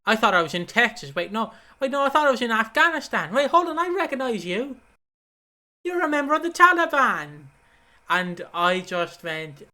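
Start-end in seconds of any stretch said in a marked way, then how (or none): 0.1-7.4 confused start climbing to a high, loud mock accusation; acted outburst. Retelling a wrestler confronting Sabu, mock accusation rising high and loud. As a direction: build from puzzlement into hammed-up alarmed recognition. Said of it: "He goes, uh".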